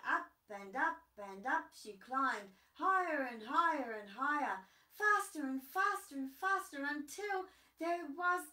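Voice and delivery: building excitement. Acted. Storyteller building the climb to a loud hooray.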